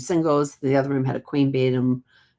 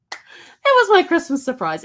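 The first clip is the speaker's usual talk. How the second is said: high slow; 5 dB louder, slower than usual. Real, not acted. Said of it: "high slow mock voice".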